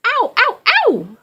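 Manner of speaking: very high, loud ow cries; pained yelps. Acted. The frog caught by the trout, high pained yelping. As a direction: yelp as if bitten.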